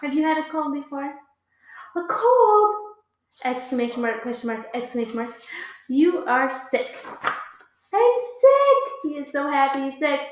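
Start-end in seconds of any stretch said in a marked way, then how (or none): 1.0-2.7 high, loud surprised question. Acted. Excited surprise. 7.9-8.9 high, loud overjoyed cry; delighted. Acted. Happy about sickness.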